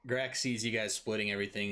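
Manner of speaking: ordinary talk, nothing marked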